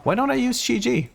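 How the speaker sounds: high-pitched self-questioning, caught by surprise; sudden realisation. Real, not acted. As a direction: voice leaps up as it dawns on you. Sudden realisation of forgotten ability.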